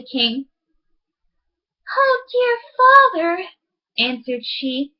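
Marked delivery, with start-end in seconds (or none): 1.9-3.4 put-on high, pleading girl's voice; high pleading princess. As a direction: beg your father, voice pitched up.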